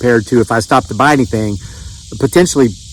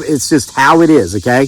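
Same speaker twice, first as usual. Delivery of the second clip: ordinary delivery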